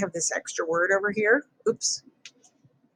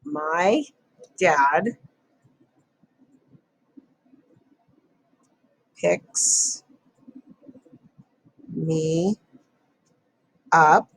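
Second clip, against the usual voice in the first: dictating; much slower than usual. Shown as a demonstration. Teacher writes sentence word by word.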